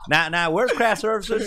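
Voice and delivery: put-on high, loud joke in a Southern accent; mock Southern accent. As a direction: play a director stuck in a Southern accent. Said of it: "you were in the accent?".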